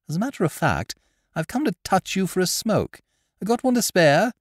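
put-on breezy young master; young gentleman. A narrator's reading.

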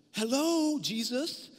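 high, sarcastic hello; mock greeting. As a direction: call to him with an ironic lilt. Sarcastic greeting to passing Jesus.

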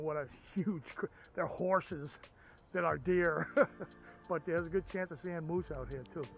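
normal talking voice

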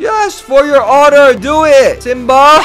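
loud, high excited cheering; wild cheering. Real, not acted. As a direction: cheer him on at full volume. Cheering the fight.

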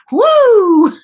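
a loud, high whoop of relief; happy cheer. Real, not acted. Celebrating testing negative.

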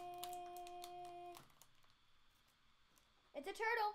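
high, happy cheer; delighted opening a present. Real, not acted. Child opening a blind box.

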